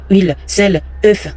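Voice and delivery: usual speaking voice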